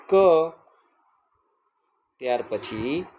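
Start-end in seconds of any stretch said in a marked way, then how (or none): 0.1-0.1 ક as a lone "kuh"; letter-sound demo. Shown as a demonstration. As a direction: sound out ક on its own. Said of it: "કેનો ઉચ્ચાર થાય છે". (ક નો અલગ ધ્વનિ ઉચ્ચાર)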